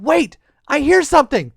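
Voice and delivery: high, loud interruption; startled little-girl exclamation; sudden alert. Acted. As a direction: stop them urgently. Girl suddenly hears crying.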